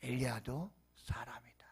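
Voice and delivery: hushed whisper. Real, not acted. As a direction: whisper it softly. Repeated point whispered.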